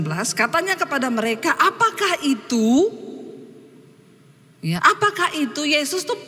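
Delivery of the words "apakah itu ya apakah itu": feigned ignorance. Retold or imitated. Jesus asking the disciples what happened, feigning ignorance. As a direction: play innocent, as if unaware. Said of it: "pura-pura nggak tahu".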